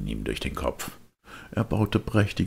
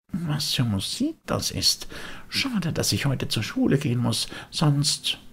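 put-on high, longing puppet boy; a boy pulled toward the music; wistful high boyish voice; Bengele's voice; 12 semitones higher than usual.

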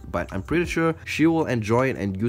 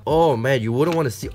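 ordinary talk, nothing marked

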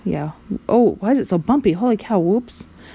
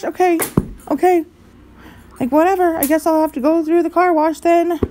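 sulky resignation; 7 semitones higher than usual. Pretend.

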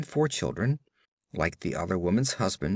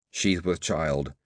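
low slow grunt; 6 semitones lower, slower than usual. Acted. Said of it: "grunted the old woman".

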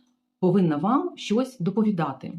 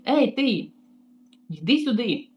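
mock yell; 4 semitones higher than usual. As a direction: bark the order like a rude boss. Receptionist calls her over.

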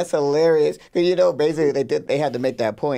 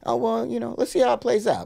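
much higher mock-breezy voice; imitating Serena; 11 semitones higher than usual. Friend joins mocking Serena's brush-off, high breezy voice. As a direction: toss it off like you couldn't care less. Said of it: "it's like".

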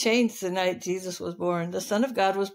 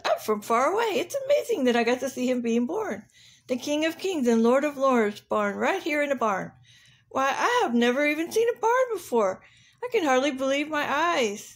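higher-pitched rhino, full of wonder; amazed rhinoceros; 5 semitones higher than usual. Acted. Rhino amazed to witness the birth in a barn, awestruck. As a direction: marvel as an astonished rhino. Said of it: "The rhinoceros said".

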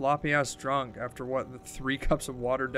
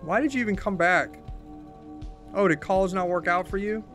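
raised pitch, accusing questions; hurt and angry; 4 semitones higher than usual. Acted. Confronting old friend who forgot her dead mom, bitterly. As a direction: pile up the accusations, each one pointed.

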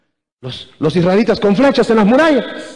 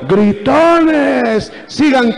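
high, stretched-out taunting calls; mocking jeers; 7 semitones higher, much slower than usual. Soldiers jeer at the marchers from the walls, mocking. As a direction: jeer down at them, dragging the words. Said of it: "Las burlas de los soldados".

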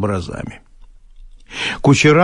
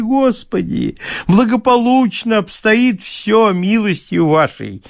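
put-on overflow with drunken gratitude; gushing; 7 semitones higher than usual. Bailiff fawns over his master, high and gushing.

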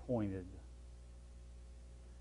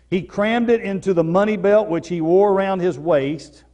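louder and higher storytelling from the pulpit; full-force preaching; 5 semitones higher, 6 dB louder, slower than usual. Real, not acted. Preacher telling congregation the Turk story, full force. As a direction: drive the story home to the pews.

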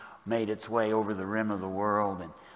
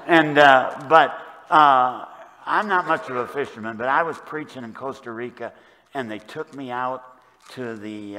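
usual speaking voice

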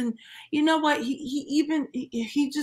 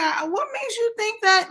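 high-pitched, exasperated disbelief; incredulous; 9 semitones higher than usual. Retold or imitated. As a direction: ask it high, as if appalled by the nonsense. Mock question to the absent man.

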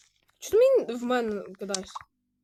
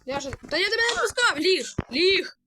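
higher, louder shouted orders; yelling command; 7 semitones higher, 6 dB louder than usual. Real, not acted. Player yells at a runaway animal to lie down. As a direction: yell at it to lie down.

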